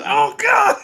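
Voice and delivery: high-pitched pained cry, half laughing; mock agony. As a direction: imitate a victim wailing in pain. Acts hooked boy crying out.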